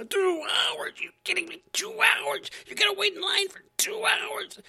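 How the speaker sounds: very high, loud, breathy shriek; hammed-up reporter shrieking; shrieking mock disbelief. Reporter peaks in shrieking alarm.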